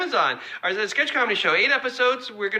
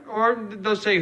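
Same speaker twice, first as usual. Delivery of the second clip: normal talking voice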